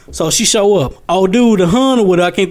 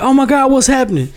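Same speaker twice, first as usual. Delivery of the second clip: put-on frightened little girl; 7 semitones higher than usual. Girl crying as massacre happens.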